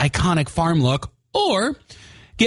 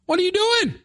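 high, incredulous question; exasperated outburst. Real, not acted. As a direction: ask it in disbelief. Repeated scolding question.

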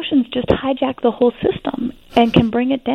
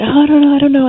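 higher, louder, whiny "I don't know"; imitating an upset teen girl; 6 semitones higher, 6 dB louder, faster than usual. Mother offers tea, daughter answers in a higher, louder fretful voice. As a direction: play the overwhelmed daughter, voice pitched up and pushed.

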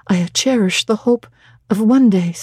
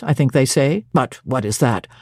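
lower, dry teasing clergyman's voice; St. John Rivers, low; 7 semitones lower than usual. A narrator's reading. Stern clergyman teasing Jane, in a lower voice. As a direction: play a reserved man gently mocking, lower.

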